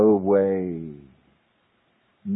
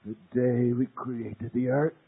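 voice of God; 2 semitones higher, 3 dB quieter than usual. Retold or imitated. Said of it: "That voice roared out of the tornado".

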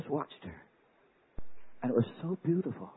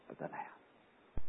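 hushed, breathy close; whisper; 12 dB quieter, much slower than usual. Real, not acted. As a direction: breathe the words out reverently. Whispered climax.

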